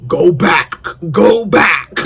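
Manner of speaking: a commanding voice in her head; lightly voiced God urging her on. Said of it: "something was telling me".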